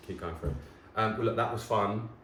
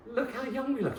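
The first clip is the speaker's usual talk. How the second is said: excited. Real, not acted. Showing an old photo with Jamie, high and excited. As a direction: gush like you've found treasure.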